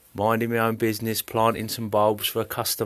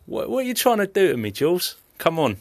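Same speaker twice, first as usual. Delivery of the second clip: emotional; 9 semitones higher than usual. Real, not acted. Caller emotional, mock protest.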